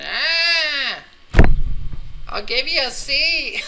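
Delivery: high, loud drawn-out wail; mock agonized scream. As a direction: scream it like a hammed-up victim in agony. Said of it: "And they're screaming".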